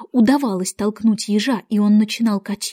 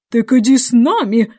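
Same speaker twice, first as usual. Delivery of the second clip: loud squawked command; shrieked order; 7 dB louder than usual. A narrator's reading. Queen orders Alice along, shrieking loudly. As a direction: shout the invitation like an order. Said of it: "взвизгнула королева".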